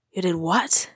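rough, hushed disbelief; hoarse whisper. A narrator's reading. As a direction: rasp it in shock. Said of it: "in a hoarse whisper".

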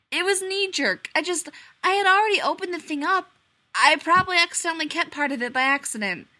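high, loud anxious babble; plead, rattled and guilty; flustered whine. A narrator's reading. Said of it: "he moaned".

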